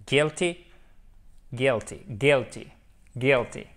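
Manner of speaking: guilty said as GHIL-tee, no U sound; silent U. Shown as a demonstration. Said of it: "on ne prononce pas le U". (guilty prononcé sans le U)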